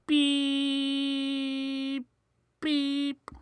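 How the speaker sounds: high long beeps; imitated phone beep. A narrator's reading. Phone hung up, high flat beeping tone. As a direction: mimic a dial tone. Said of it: "Phone is hung up hard".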